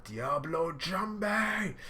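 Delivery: drawn-out high attack name; attack name call. Retold or imitated. Sanji calling his flaming kick, slow drawn-out yell. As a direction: stretch the attack name out slowly. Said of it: "He's like".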